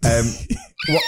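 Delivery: squeaky high animal call; otter noise impression. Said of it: "This is incredible".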